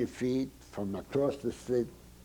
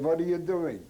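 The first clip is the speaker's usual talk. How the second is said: sharply higher, alarmed question; a boy's retold yelp of alarm; startled cry; 8 semitones higher, 4 dB louder than usual. Startled boy caught and hit.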